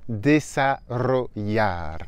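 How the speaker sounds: desarrollar slowly, rolled rr and ll as y; slow model word. Shown as a demonstration. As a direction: stretch out desarrollar syllable by syllable. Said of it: "Un autre mot".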